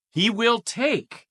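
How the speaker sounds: He will take, slow and high, leaning hard on will; stress on take. Shown as a demonstration. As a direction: draw out He will take with weight on will. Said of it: "I tried to emphasize it for you".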